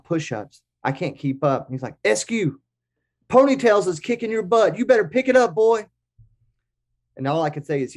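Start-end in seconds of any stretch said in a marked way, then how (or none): 2.0-5.9 drill sergeant bark. Retold or imitated. Old drill sergeant taunting the narrator during push-ups, mock barking. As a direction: bark it like an old Marine pushing a recruit.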